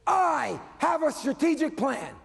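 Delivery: hammed-up shout of a dull line to a huge crowd; mock grand oration. Speaker to the crowd, mocking a dull slogan with a slow booming shout. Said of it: "and he gets out there and he says".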